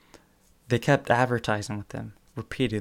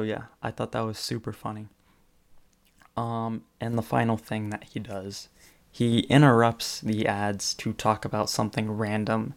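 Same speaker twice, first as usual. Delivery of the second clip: ordinary talk, nothing marked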